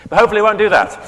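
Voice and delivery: sudden high, loud retraction; bright jump up to a hopeful correction. Real, not acted. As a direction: snap back up, hopeful and emphatic.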